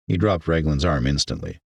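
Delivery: ordinary delivery, nothing marked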